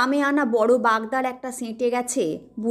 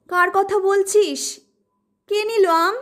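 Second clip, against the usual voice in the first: anxious questioning; 7 semitones higher than usual. Acted. Mother demanding anxiously.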